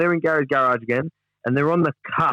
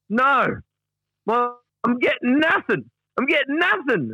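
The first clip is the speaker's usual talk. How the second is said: high-pitched, drawn-out mock protest; petulant refusal; 11 semitones higher, slower than usual. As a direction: act out an aggrieved refusal, pitched right up. Voicing Gary's protest from transcript.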